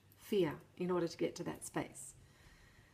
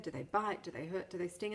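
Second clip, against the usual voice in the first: ordinary talk, nothing marked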